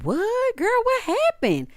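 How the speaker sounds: high, drawn-out shocked "what"; shocked gasp. Retold or imitated. Cast member reacting in shock, high and stunned.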